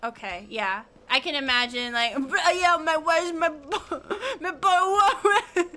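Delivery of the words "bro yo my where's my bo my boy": loud mock British accent breaking into laughter. Imagining British people smoking weed, laughing hammed-up British accent. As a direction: ham up a British stoner, laughing through it. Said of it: "a British accent just being like".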